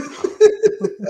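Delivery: high burst of real laughter. Real, not acted. Laughing at own story.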